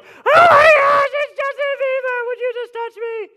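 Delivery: high shrieking fangirl squeal; screaming fan. Pretend. Pastor mimicking a fan at [name], shrieking.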